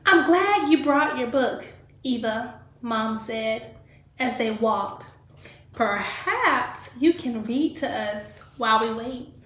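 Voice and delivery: lively read-aloud storytelling voice; reading to children. Acted. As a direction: read the story to a class of kids. Teacher reads picture book aloud.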